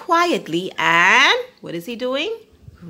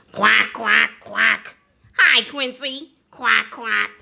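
a touch of a noisy duck calling out; mild duck shout. A narrator's reading. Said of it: "shouts Dotty".

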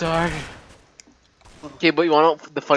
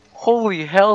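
ordinary talk

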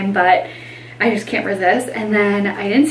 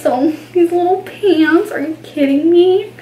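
gushing; 7 semitones higher, 3 dB louder than usual. Real, not acted. Gushing over baby pants.

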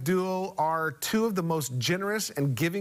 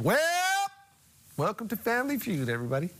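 Steve Harvey; 6 semitones higher, much faster than usual. Retold or imitated. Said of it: "he goes".

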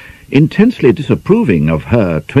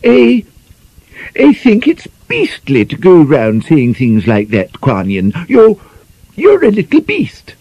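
high, stammering angry outburst, rising to a near shout; 11 semitones higher, 3 dB louder than usual. Acted. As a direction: lose your temper at your friend. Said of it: "Gwynifred was really angry".

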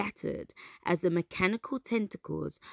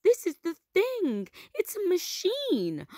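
much higher, thrilled discovery; excited; 12 semitones higher, 3 dB louder than usual. Acted. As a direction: announce it breathless with wonder. Milo excited about discovery.

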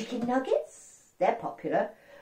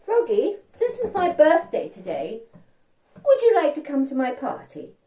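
higher, louder princess invitation; 7 semitones higher, 6 dB louder than usual. Acted. Princess inviting Froggy to her birthday party, eager. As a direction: lift into an eager girl's voice.

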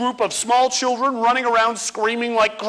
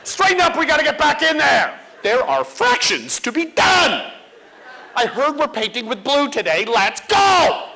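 put-on impatient kid; 4 semitones higher than usual. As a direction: hurry your classmates into line. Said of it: "You would be losing it on your friends".

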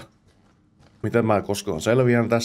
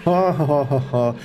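laughing; 4 semitones higher, slower than usual. Real, not acted. Spelt laugh after escaping.

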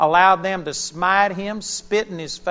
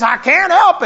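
1 loud protest; 10 semitones higher, 7 dB louder than usual. Retold or imitated. Woman protesting being removed.

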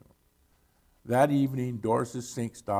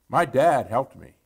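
put-on louder, mildly proud child's claim; beaming; 7 dB louder than usual. A narrator's reading. Billy speaks up from the back of class, lightly beaming. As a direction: punch it out with understated pride. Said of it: "he, he beamed".

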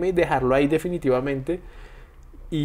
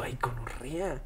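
much softer, whispered curse of defeat; whispered groan; 12 dB quieter, slower than usual. Retold or imitated. Worn down by the puppy's mess, whispered groan. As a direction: breathe it out, worn down. Dog messing everywhere, exhausted.

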